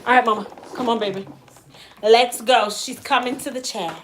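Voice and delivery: usual speaking voice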